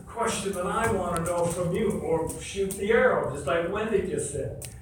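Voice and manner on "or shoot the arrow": ordinary talk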